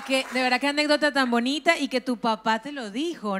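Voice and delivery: normal talking voice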